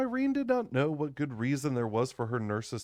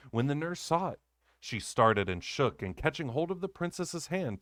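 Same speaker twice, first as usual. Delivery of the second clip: ordinary delivery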